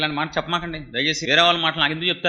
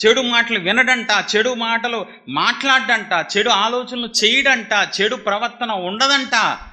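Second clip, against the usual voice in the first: loud, urgent Telugu sermon; fiery preaching; 5 dB louder than usual. Acted. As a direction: preach it hard to the congregation. Preacher's sermon at full force.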